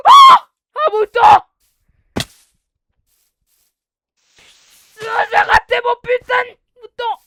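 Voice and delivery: high, loud raging yell; furious shout. Real, not acted. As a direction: explode in frustration. Misses sheep, furious.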